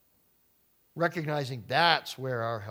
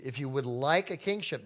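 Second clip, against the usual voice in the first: ordinary delivery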